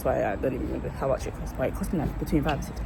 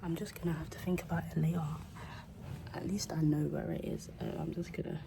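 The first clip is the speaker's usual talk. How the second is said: abruptly softer, hushed; 8 dB quieter than usual. Real, not acted. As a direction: drop to a hush suddenly. Drops voice entering library.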